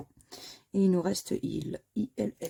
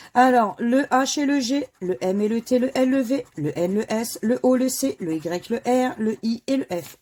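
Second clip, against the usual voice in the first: raised, loud call of each letter; higher, louder bingo-caller style; letter draw announcement; 3 semitones higher, 7 dB louder than usual. Acted. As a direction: announce every letter like a game-show draw. Calls out the drawn letters.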